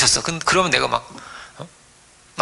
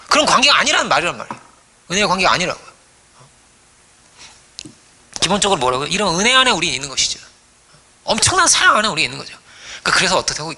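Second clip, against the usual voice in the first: ordinary talk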